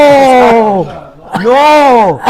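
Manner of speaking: loud emphatic no; emphatic shout. Real, not acted. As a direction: shout down the objection.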